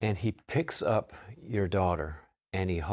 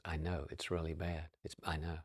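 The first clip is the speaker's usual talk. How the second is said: soft, downcast agreement; hushed, resigned acknowledgement; subdued admission; 3 semitones lower, 11 dB quieter than usual. Retold or imitated. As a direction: concede it very quietly. Mom admits it is bad.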